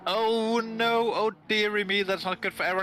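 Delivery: excited race call. Real, not acted. Calling Aaron's spin for the stream, excited. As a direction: react to the spin like a live commentator.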